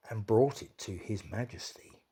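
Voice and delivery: plain reading voice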